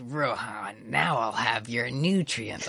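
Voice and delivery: low, slow, creepy stand voice; shitty Highway Star voice. Retold or imitated. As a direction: drag it out soft and creepy. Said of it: "remind me of what it sounds like".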